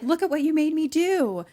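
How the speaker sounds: high, loud accusing cry; mock anguished blame. Gunman blaming the wounded Garrett, hammed-up outcry. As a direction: hurl the blame in a rising wail.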